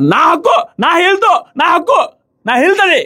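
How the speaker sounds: retelling her outburst as a shrill mock shout; mock yell. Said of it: "అనేసి గట్టిగా అరిచారు".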